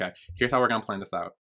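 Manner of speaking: quick, soft private planning; imitated self-talk. Acting out his private planning, quick and quiet. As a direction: hurriedly mumble a plan to yourself. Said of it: "but, like, by himself".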